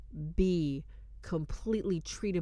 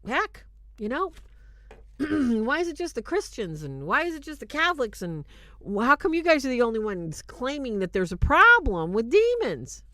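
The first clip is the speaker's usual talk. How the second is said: a lightly voiced sceptic, higher and louder; a hint of an indignant sceptic, higher and louder; mocking skeptic; 7 semitones higher, 8 dB louder than usual. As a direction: imitate the doubters' complaint, half-mocking. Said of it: "a lot of people say".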